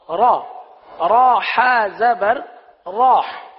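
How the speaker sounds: را said loud with mouth wide open. Shown as a demonstration. Teacher drills the open vowel, loud and slow. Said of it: "منہ اور آواز کھول کر".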